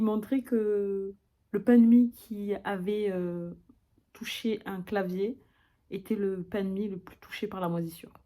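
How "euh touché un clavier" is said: usual speaking voice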